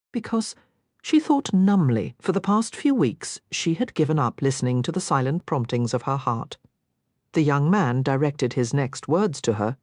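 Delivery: usual speaking voice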